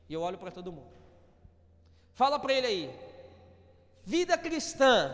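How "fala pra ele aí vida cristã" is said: loud prompt. Real, not acted. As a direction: lead the crowd to repeat the phrase. Prompting congregation to repeat.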